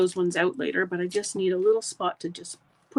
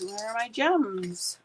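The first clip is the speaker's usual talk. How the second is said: ordinary talk, nothing marked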